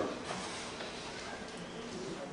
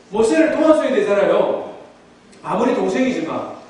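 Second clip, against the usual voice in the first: ordinary talk, nothing marked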